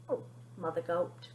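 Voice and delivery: usual speaking voice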